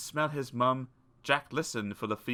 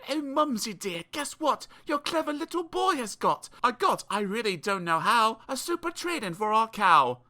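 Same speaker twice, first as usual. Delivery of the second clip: put-on high boyish voice; a pleased little boy boasting; Jack; 10 semitones higher than usual. A narrator's reading. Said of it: "and said".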